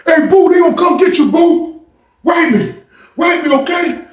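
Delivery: high, loud shouted call. Retold or imitated. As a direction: shout across the tier to him. Kurt calls to cellmate.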